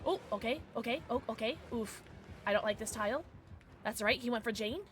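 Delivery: usual speaking voice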